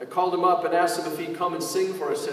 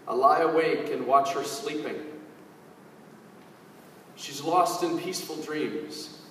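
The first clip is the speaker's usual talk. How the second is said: reciting song lyrics; 3 dB quieter than usual. Acted. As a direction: speak the lyrics gently like a poem, unhurried. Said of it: "share some lyrics to one of his popular songs".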